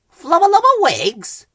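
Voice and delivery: high, loud insistent gibberish; demanding. A narrator's reading. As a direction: demand it, pushing hard. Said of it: "demanded Ben".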